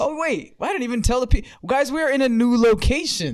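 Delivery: high excited oh wait; sudden realization. Real, not acted. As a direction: blurt it out as you remember. Suddenly remembers announcement.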